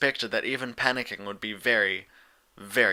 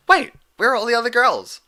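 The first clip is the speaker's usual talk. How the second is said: high, loud sudden worry; worried Pinkie; 13 semitones higher, 8 dB louder than usual. Acted. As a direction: snap to alarm in a squeaky voice. Pinkie notices friends missing.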